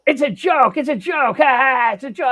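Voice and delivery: mock hollering. Mimicking the heckling woman at the overlook, mock holler. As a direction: ham up her shrieking insistence. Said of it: "she started hollering at me".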